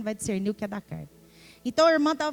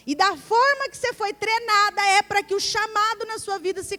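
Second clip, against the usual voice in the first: bispa pregando; 8 semitones higher, 9 dB louder than usual. Retold or imitated.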